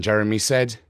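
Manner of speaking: ordinary delivery, nothing marked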